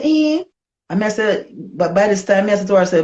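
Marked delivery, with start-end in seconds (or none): 0.0-0.4 high surprised eh. Retold or imitated. Retelling her surprise at Lady G's offer, high eh. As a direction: react in disbelief.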